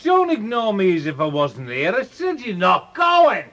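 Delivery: put-on loud drunken shouting at his daughter. Drunk father shouting at daughter who plans to leave. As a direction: bellow it at her, furious. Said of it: "Will you stop shouting?".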